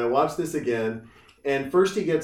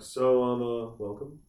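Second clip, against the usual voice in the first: hushed, low, halting mock pastor; very soft, low murmur with hesitant pauses; soft-spoken pastor; 3 semitones lower, 7 dB quieter than usual. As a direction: play a meek pastor easing gently into the ask. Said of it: "when you hear pastors talk about it".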